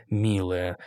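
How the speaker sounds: dryly. A narrator's reading. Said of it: "сухо сказал я".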